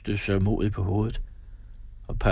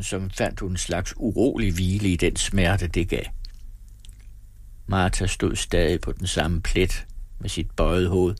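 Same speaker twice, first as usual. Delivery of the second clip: ordinary delivery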